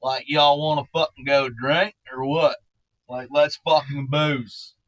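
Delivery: slow, lightly put-on Southern drawl, imitating a party kid. Imitating the Southern kid inviting them drinking, in a slow, mild Southern drawl. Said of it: "Southern drawl".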